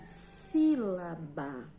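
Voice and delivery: teacher stretching out a new term; slow term reveal. Shown as a demonstration. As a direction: announce "dissílaba" loudly, piece by piece. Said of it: "como será que se chamam".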